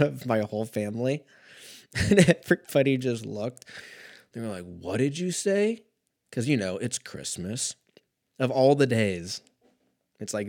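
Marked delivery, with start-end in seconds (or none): 4.6-5.8 retold shock. Retold shocked family reaction.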